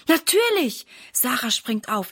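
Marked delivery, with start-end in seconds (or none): 0.1-0.9 eager yes. A narrator's reading. Eager daughter agrees to help.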